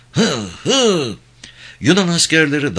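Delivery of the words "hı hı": two high grunts of exertion; effort grunts. A narrator's reading. Soldier thrusting in bayonet fight, grunting with effort. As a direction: heave out each breath like striking a blow.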